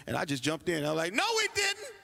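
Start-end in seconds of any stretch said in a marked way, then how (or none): 1.1-1.9 sudden high shout of protest; blurting shout. Retold or imitated. Kid blurts out the truth.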